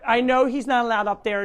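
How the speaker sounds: ordinary talk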